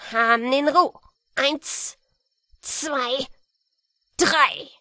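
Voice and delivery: loud forceful robber; a stern bandit laying down terms. A narrator's reading. Robber argues and counts.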